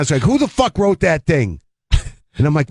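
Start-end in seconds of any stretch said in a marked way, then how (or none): 0.2-1.6 high-pitched mock angry rant; imitating a yell. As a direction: play the cranky old comic snapping at a stranger. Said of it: "Shecky Greene yelling at me".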